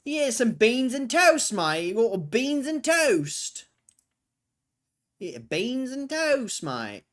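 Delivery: high, loud, drawn-out mock British; British accent. Joking about beans and toast, exaggerated British accent. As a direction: stretch it slowly in a hammed-up English accent. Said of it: "now my British accent's shit".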